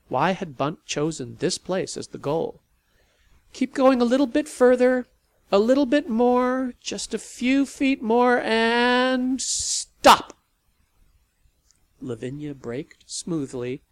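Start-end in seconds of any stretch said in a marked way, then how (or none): 3.5-10.4 high, loud step by step guidance; guiding call. Acted. Sally guiding Lavinia to park, loud calls. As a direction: ease her in, then stop.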